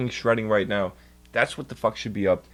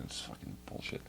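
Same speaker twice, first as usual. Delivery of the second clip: whispered curse; 19 dB quieter than usual. Real, not acted. Frustrated muttering over a cable.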